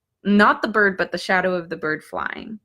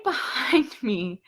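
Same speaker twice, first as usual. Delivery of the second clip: ordinary delivery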